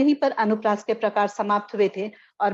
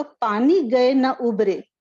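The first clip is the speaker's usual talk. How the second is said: पानी गए न उबरे, slow, each word weighed; slow, explaining recital of the verse line; slow recitation; 2 semitones higher, much slower than usual. Shown as a demonstration. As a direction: dwell on पानी as you repeat the line. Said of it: "मतलब अगर पानी न रहे तो".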